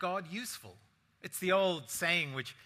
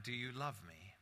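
quiet; 5 semitones lower, 10 dB quieter than usual. Acted. Peter hurt by third asking.

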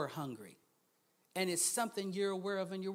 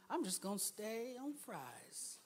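drawn-out slow delivery; slow punchline; 9 dB quieter, slower than usual. Shown as a demonstration. Preacher repeating a brother's saying, slow punchline. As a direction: land it slowly as a punchline. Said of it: "Stop trying to get in everybody's lane".

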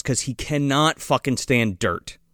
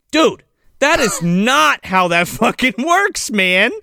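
incredulous, emphatic telling-off; mock indignant retort; 9 semitones higher than usual. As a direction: argue back in higher-pitched disbelief. Acted retort to the militia leader.

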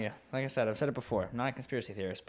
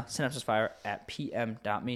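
ordinary talk, nothing marked

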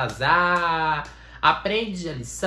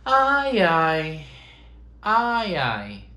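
mantra sighs; 2 semitones higher, 5 dB louder, much slower than usual. Shown as a demonstration. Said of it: "Mexe os ombros e faz".

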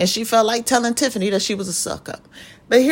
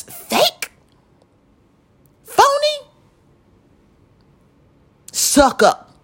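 mock squeal; much slower than usual. Mocking what people call her.

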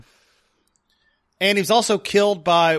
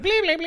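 high sci-fi beeps; 13 semitones higher than usual. Pretend. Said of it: "it's like".

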